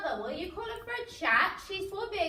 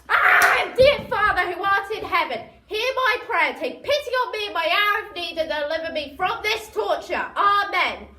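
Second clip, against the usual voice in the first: loud theatrical prayer; hammed-up prayer; 8 dB louder than usual. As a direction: pray to the heavens in mock despair. Mock prayer for R E.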